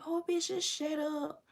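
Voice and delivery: high, soft, dismissive put-on voice; mocking retort. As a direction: brush her off with scorn. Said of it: "she was just like".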